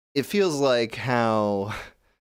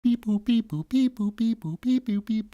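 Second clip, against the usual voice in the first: high beep-boops, a touch softer; slightly quieter imitated beat; 12 semitones higher than usual. AI replaying the lowered beat, slightly softened beeps. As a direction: mimic a synth loop turned down a little. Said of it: "I lowered the volume".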